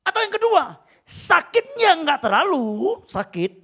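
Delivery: loud, high-pitched sermon delivery; impassioned preaching. Acted. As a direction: preach it forcefully, stretching the final word. Preaches at full force.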